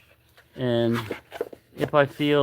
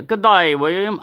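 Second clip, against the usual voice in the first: mock Australian accent; 7 semitones higher, 5 dB louder than usual. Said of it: "I can say his Australian accent".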